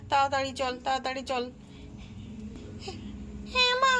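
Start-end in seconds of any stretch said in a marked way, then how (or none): 3.5-3.9 put-on high, bright little girl answering mother; child voice. Daughter answering her mother in a high child voice. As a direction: pitch up into a young daughter's voice.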